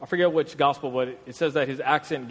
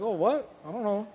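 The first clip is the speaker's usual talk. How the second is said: higher, mock-innocent "I don't know"; mock feminine dodge; 5 semitones higher, 4 dB quieter than usual. Peter dodging the servant girl, high mock-innocent voice. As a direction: play dumb in a high mock voice. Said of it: "he's acting like a female now".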